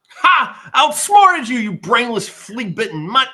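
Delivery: high, loud, cocky jeering at the dog below; gloating taunt. Acted. Joxer safe up the tree, mocking the wolf loudly. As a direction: crow over the wolf you escaped.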